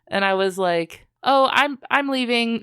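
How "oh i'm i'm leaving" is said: higher-pitched flustered self-quote; flustered apology. Retold or imitated. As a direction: re-enact being caught out, a touch hammed-up. Caught trespassing, retold self-quote.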